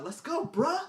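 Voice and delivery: high, cocky frat bro taunt. Retold or imitated. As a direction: talk tough at the bar.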